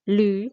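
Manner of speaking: "lu" with a rising glide; second tone. Shown as a demonstration. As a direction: let "lu" climb at the end. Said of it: "naiknya sedikit aja".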